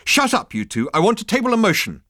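high, loud, strident demand; strident girl. A narrator's reading. Girl leaps up to silence both, strident. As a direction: cut across them stridently. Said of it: "A girl with a strident voice".

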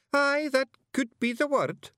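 very high Scots; musing aloud; thoughtful Feegle. A narrator's reading. Awfully Wee Billy ponders for Miss Level in a very high voice.

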